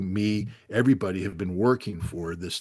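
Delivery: usual speaking voice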